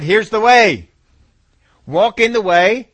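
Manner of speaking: loud, ringing call from the Father; proclaiming. Acted. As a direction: proclaim it like a summons. Preacher voices the Father's call.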